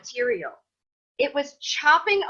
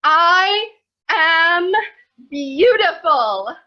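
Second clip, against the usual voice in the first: loud, higher, slow, unconvincing; forced affirmation; 5 semitones higher, 9 dB louder, much slower than usual. Pretend. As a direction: push it out like you don't believe it. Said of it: "And you're like".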